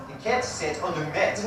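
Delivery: the cat sat on the mat said as "the ket set on the met"; South African vowel shift demo; South African accent. Shown as a demonstration. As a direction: swap every A for an E, South African style. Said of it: "replace the A with an E".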